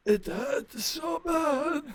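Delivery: giggling mock agony; laughing pain. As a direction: laugh through fake pain.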